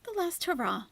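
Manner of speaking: high mocking repeat of her words; mocking echo. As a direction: parrot her phrase with scorn. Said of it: "This is a last hurrah".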